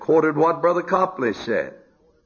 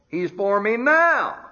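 preaching at full force; 10 semitones higher than usual. Acted. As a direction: lift it and proclaim it. Refrain rising to full force.

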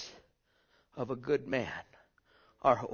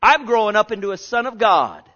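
preaching at full force; 5 semitones higher, 12 dB louder than usual. Acted. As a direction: proclaim it to the congregation.

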